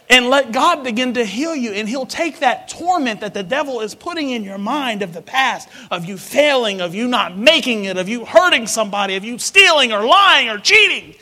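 building shout. Acted. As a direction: preach the list up to a shout. Building preaching list.

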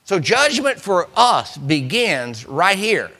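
ordinary delivery

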